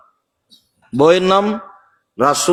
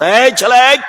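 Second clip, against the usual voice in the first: high, raised call for attention; 7 semitones higher, 4 dB louder than usual. Real, not acted. Calls out to a boy mid-sermon. (উঁচু গলায় ছেলেকে ডাকা)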